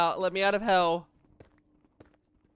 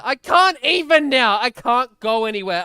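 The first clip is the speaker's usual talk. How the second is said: loud, raised-pitch venting; exasperated complaint; 5 semitones higher, 9 dB louder than usual. Real, not acted. Streamer answering pushy friend that he's trapped, exasperated. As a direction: burst out in exasperation, easing off slightly.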